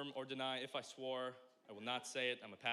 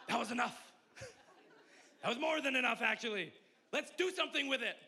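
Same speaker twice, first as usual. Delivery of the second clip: laughing excitedly; 9 semitones higher, 6 dB louder than usual. Real, not acted. Laughing at his own joke.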